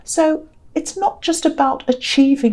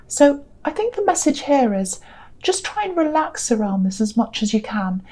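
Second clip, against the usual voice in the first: ordinary delivery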